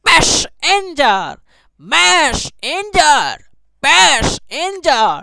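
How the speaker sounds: প্যাসেঞ্জার and মেসেঞ্জার split in two, slow and high, each half stretched; exaggerated syllable drill. Shown as a demonstration. Teacher drilling rhyming words, প্যাসেঞ্জার and মেসেঞ্জার slow and high in halves. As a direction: stretch "mesh" or "pesh", pause, then "en-jar" in প্যাসেঞ্জার, মেসেঞ্জার.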